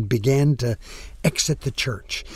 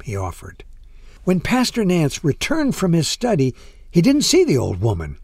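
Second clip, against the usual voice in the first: ordinary delivery, nothing marked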